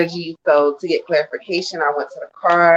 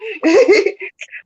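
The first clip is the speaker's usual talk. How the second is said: sudden loud high laugh; high burst of laughter; 3 dB louder than usual. Real, not acted. Reader laughing after being cut off by the host. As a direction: break into a bright surprised laugh.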